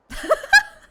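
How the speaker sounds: sudden loud high-pitched laugh; a real, explosive laugh of relief; burst of laughter. Real, not acted. Streamer laughing loudly at the game's end. Streamer reacts after long story.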